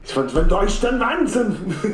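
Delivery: high, exaggerated German accent. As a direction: say it like a hammy German. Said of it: "that accent was crazy".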